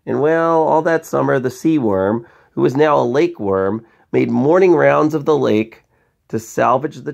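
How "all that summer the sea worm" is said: ordinary delivery, nothing marked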